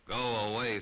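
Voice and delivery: usual speaking voice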